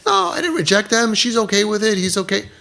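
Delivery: high-pitched imitation of a parent in denial; put-on high voice making excuses; mock defensive protest.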